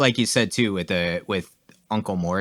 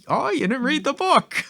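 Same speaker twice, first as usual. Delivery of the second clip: high, mocking leprechaun voice. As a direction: taunt like a cheeky leprechaun. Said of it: "even the leprechaun was giving him shit".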